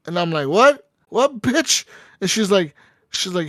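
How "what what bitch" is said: squeaky offended retort, pitched well up; high, outraged What; indignant high What. Retold or imitated. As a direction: act insulted, voice jumping up high. Indignant retort to a rude lounge hostess.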